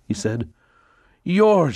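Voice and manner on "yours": high, loud outcry; cried out. Acted. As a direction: fling the word out as a cry. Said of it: "she cried".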